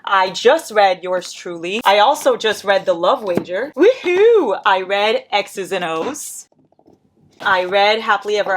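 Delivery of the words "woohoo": high whooping cheer; cheerful whoop. Real, not acted. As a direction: celebrate with a little whoop.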